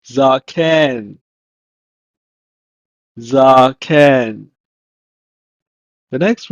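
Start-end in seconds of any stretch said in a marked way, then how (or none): 0.1-4.5 zaken in two careful syllables, falling; Hebrew pronunciation. Shown as a demonstration. Teacher gives the Hebrew for old, careful zaken. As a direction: model "zaken" clearly, then repeat. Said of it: "is pronounced as".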